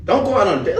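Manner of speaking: usual speaking voice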